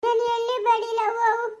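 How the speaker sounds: high, wailing protest; tearful refusal. Acted. Crying woman refusing to come along, wailing. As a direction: refuse through sobs.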